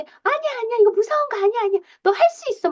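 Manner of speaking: high-pitched coaxing parent; insistent encouragement. Acted. Parent urges child scared of the slide, insistently encouraging. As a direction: talk the fear away, voice way up. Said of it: "격려를 해주려는 의도죠".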